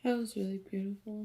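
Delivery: soft, quick, teary praise; fighting tears, voice soft; holding back tears. Real, not acted. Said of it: "I'm trying not to cry again".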